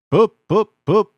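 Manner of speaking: very high, loud whistle calls; whistle toots. A narrator's reading. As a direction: toot like an engine. Engine whistling.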